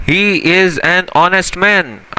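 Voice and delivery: very slow, word-by-word He is an honest man; slow example read. Shown as a demonstration. Teacher reading the example sentence very slowly. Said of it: "अभी इसकी example देखते हैं".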